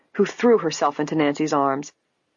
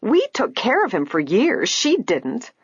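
high, indignant protest; aggrieved, swooping outburst; angry; 8 semitones higher, slower than usual. A narrator's reading. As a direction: complain angrily, leaning on the key words. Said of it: "Angrily she turned to her mother".

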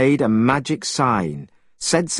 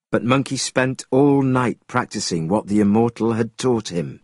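ordinary delivery

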